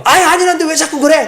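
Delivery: high, loud annoyed yell; exasperated shout. Retold or imitated. Peter yells at the servant girl to stop, loud and high. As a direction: snap at her to stop. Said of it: "소리를 막 질렀던 것 같아요".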